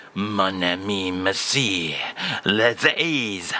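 lightly hammed French pronunciation; French phrase. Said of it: "today's phrase, Charles, is".

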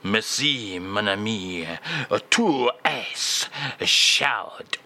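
low, very slow mock French phrase; slow French drawl. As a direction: stretch tu es chaud out slowly in French. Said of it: "And again, please, [name]".

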